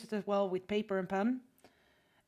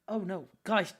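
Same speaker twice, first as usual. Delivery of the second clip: normal talking voice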